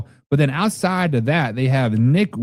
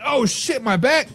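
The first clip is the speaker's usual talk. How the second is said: mock injured player; 9 semitones higher than usual. Acts out old player getting hurt.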